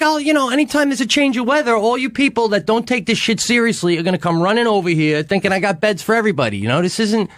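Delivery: lecturing chalet lady. Retold or imitated. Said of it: "she's like".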